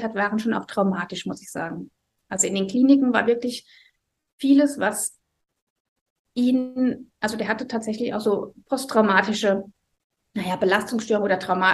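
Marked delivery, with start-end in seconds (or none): none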